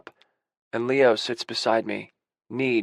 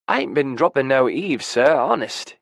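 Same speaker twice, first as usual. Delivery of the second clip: louder, hammed-up dopey British voice; dopey quasi-British accent; 3 semitones higher, 5 dB louder than usual. A narrator's reading. Friend caught eavesdropping quotes a film, dopey quasi-British accent. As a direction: put on a silly, half-British accent. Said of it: "in a dopey quasi-British accent".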